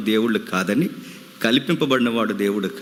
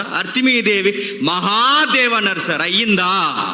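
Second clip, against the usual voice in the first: fiery preaching; 6 semitones higher than usual. Acted. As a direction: thunder it at the congregation. Preacher rebukes the crowd.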